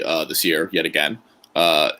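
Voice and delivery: ordinary talk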